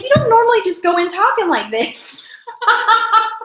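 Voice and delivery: high, amused, laughing through the words; real laughter bubbling through the sentence. Real, not acted. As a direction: laugh while you protest. Laughing at her script mix-up.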